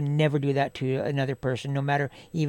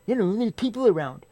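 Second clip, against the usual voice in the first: higher-pitched, uptight telling-off; imitated uptight scolding; 5 semitones higher than usual. Uptight co-worker objecting to the swearing, imitated scolding. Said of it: "he got all fucking uptight".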